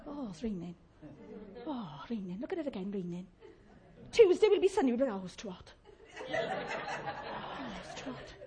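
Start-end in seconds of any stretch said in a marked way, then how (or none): 0.1-3.3 soft, low grumble about the rain; glum moaning. Acted. Preacher mimics church folk whingeing about rain, glum moaning. Acting out a moaner about weather. 5.2-8.3 soft, breathy moan about the heat; put-on feeble complaint, barely voiced; mock moaning. Preacher mimics people moaning about sunny weather, mock moaning.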